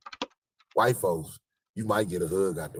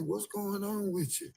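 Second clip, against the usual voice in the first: much higher, slow question; mock concerned passerby; 13 semitones higher, 4 dB quieter, slower than usual. Stranger asking the sad clown, much higher slow. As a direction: sound puzzled and caring.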